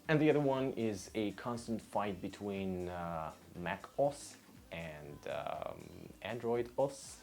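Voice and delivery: ordinary talk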